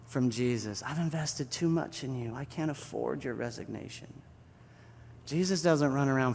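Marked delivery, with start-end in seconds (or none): 0.8-4.2 soft, intimate insistence; hushed reassurance. Acted. Jesus tells a quitter he won't accept, hushed. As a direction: refuse it quietly.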